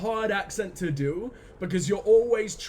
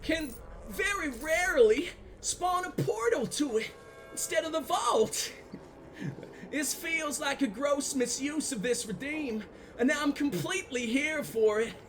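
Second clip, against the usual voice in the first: mock Southern accent; 7 semitones higher, 4 dB quieter than usual. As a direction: ham up a Southern accent reading chat. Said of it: "in an approximation of your accent".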